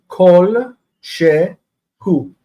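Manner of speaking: "kol shehu" drawn out very slowly; slow teaching pronunciation; slow demo. Shown as a demonstration. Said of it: "this word".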